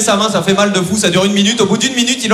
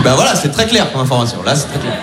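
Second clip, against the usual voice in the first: sarcastic; 8 semitones lower than usual. Retold or imitated. Sarcastic reply to doctor.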